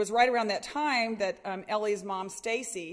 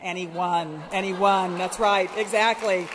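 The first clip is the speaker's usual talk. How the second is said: loud rally call; 10 dB louder than usual. Real, not acted. Cheering crowd at rally.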